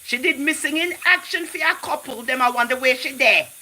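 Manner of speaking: high, loud excited outcry; screaming. Real, not acted. Livestream host telling viewers about the woman, screaming. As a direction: holler it at a high, sustained pitch. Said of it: "If I was screaming, I'm sorry".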